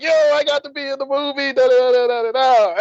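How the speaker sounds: high, elated mock bragging; happy yell trailing into da-da-das. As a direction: act out crowing with joy. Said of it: "So I'm all, like, happy".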